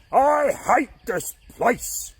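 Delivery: slow, high, loud shout; loudest shout. Acted. Caveman covered in mud, shouting his loudest. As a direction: bellow it out with all you have. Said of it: "shouted the loudest shout he could".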